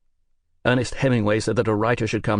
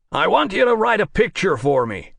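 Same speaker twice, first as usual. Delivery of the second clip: American producer voice; 9 semitones higher, 5 dB louder than usual. Acted. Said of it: "work a lot harder on that American accent".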